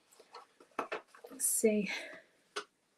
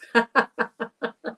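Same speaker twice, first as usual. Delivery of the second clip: hearty laugh; 3 semitones higher, 5 dB louder than usual. Real, not acted. Laughter exercise.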